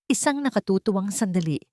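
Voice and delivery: ordinary delivery, nothing marked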